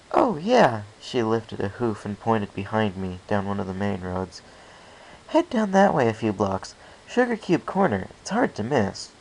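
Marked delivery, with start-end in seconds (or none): 0.1-0.8 a lightly voiced mare, higher and louder; distracted mare. Local mare answering a stranger while distracted, lightly voiced. 5.3-9.1 a lightly voiced mare, higher and louder; distracted mare. Local mare answering a stranger while distracted, lightly voiced.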